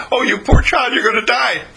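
high, loud mock-pity voice; hammed-up pity for a sick child; pitying parent.

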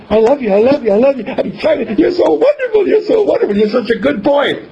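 overjoyed. Retold or imitated. Mother hugging her son, gushing love in a high giddy voice. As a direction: pour it out, giddy with joy, breaking into a laugh.

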